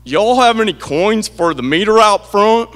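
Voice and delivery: put-on loud, slow Southern accent; imitating a booming Southern tourist; Southern drawl. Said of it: "it went something like this".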